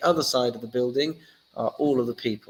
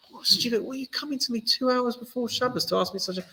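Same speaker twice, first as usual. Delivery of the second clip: higher, exasperated mock rant; mock outrage; 12 semitones higher than usual. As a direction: play the irritated sage who snaps. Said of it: "That's not how Hillel responded".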